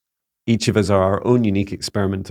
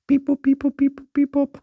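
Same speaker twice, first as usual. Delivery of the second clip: very high robotic beeping; beep boop noises. Pretend. Playful robot sound between questions.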